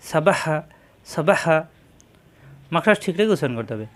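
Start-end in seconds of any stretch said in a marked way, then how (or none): 0.1-1.6 সাবাহা said briskly, each short vowel clipped fast with no pause; teacher modelling a quick সাবাহা for the class; quick syllables. Shown as a demonstration. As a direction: run সাবাহা together quickly. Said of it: "হারকতের উচ্চারণ তাড়াতাড়ি".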